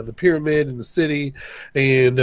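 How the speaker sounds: ordinary delivery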